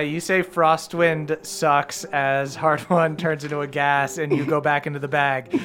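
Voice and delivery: ordinary talk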